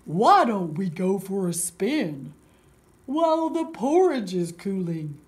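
big bear's loud, slow voice; Papa Bear. Acted. Papa Bear proposing a bike ride, loud booming voice. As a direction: boom it out like a big old bear. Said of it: "said Papa Bear".

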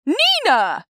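high, loud cry of the name; loud call. Acted. Mother interrupting the grape game, loud high call. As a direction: shout the name to stop her. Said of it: "then I heard a loud".

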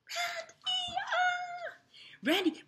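high-pitched drawn-out fan scream; screaming fangirls. Acted. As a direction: squeal like a crowd of adoring girls. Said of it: "las chicas gritaban". (chillidos de admiradoras)

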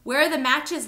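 loud, high demand; urgent cry. A narrator's reading. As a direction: shout for help urgently. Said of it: "Lito cried".

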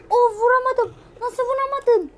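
high loud frustrated squawk; outraged disbelief. Real, not acted. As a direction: yell at yourself for missing. Missed the shot.

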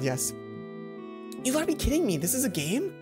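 ordinary talk

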